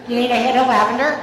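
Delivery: loud, disbelieving question, rising at LAVENDER; incredulous echo. Retold or imitated. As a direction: repeat it as if you can't believe it. Incredulous repeat of the offer.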